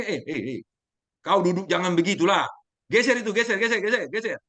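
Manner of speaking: high, loud, brisk Sumatran-style telling-off; blunt Sumatran manner. Retold or imitated. Sumatran rebuking someone for sitting improperly, loud and blunt. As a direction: bark it out Sumatran style, no softening. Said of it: "kalau orang dari Sumatera lagi".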